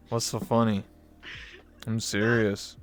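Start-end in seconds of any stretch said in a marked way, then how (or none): none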